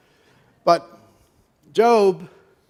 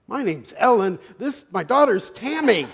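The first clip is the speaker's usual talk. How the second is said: higher, louder punchline retort; mother's emphatic correction; 6 semitones higher, 6 dB louder than usual. Retold or imitated. Mother correcting the admiring stranger, acted exasperated emphasis. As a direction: set him straight, voice jumping up.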